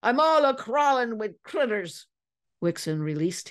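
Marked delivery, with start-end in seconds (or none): 0.0-2.1 put-on high old whaler's wail; shrieked. A narrator's reading. Itching prisoner complains to his captor, shrieking. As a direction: wail it high, crawling with itches. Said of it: "Ellsworth shrieked".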